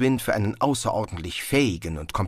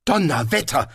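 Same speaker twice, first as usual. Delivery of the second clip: raised, impressed exclamation; erstaunter Ausruf; 6 semitones higher than usual. A narrator's reading. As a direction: let it slip out, amazed. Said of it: "entfuhr es Zweiblum". (staunend herausgerutscht)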